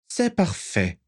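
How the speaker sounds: high-pitched villain voice; a dark lord giving approval, pitched high; Voldemort. A narrator's reading. Voldemort's dialogue in narrated story. (voix aiguë de Voldemort)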